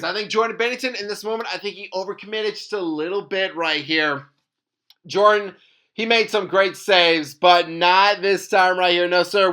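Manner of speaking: ordinary delivery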